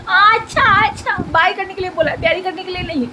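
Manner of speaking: high, teasing scold; playful scolding. Real, not acted. Mother teasing daughter for kissing instead of bye, high playful scold.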